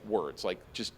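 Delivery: ordinary delivery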